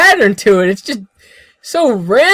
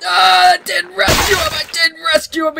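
dismayed outburst; 6 semitones higher than usual. Real, not acted. Dismay at failing the rescue.

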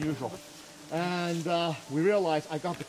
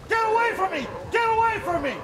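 high, loud re-enacted scream; screamed warning; 4 dB louder than usual. Hunter to charging bear, screaming. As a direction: scream to drive the threat back. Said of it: "and I screamed".

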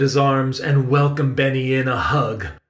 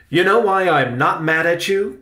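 uncle's leading question; 5 semitones higher, 4 dB louder than usual. A narrator's reading. Uncle reassures and teases out reason.

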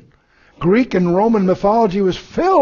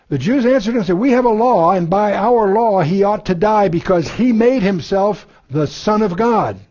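scripture reading; 3 semitones higher than usual. Acted. Reads scripture passage aloud.

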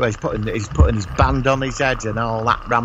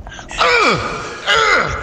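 high mock yell of a jumping action hero; squeaky hammed-up war cry; Rambo battle yell. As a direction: imitate Rambo's leaping scream. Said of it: "them noises".

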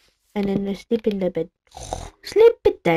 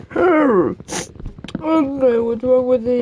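higher, louder goofy voice; silly put-on voice; 4 semitones higher, 5 dB louder than usual. Real, not acted. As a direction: goof around in a funny voice. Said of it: "I'm just talking like this all the time".

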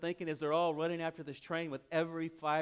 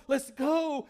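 urging shout; 7 semitones higher, 5 dB louder than usual. Retold or imitated. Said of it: "he's like".

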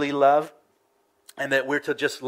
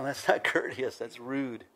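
normal talking voice

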